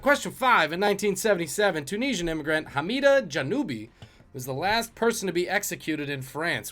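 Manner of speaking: game show question delivery; quiz host read. Acted. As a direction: announce it like a trivia host.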